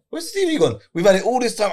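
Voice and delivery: high-pitched puzzled question, re-enacted; retold bafflement. Man retelling the missing TV story, mock bafflement. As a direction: replay the baffled moment, pitched right up.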